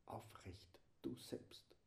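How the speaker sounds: soft unvoiced whisper. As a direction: breathe it intimately. Whispered close of meditation. (leise geflüstert)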